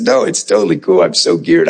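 a lightly played cool reply; mock nonchalant. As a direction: brush off the family's worry casually. Reassuring family he is fine.

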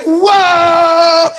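high, laughing exclamation of shock; startled whoa. Retold or imitated. Preacher reliving being caught by God, laughing shock. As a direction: yelp it out through laughter. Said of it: "I was like".

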